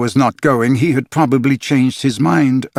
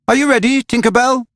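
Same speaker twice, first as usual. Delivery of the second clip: much higher, ringing call; 12 semitones higher than usual. A narrator's reading. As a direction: call up to Tinker Bell across the room. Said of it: "he called out".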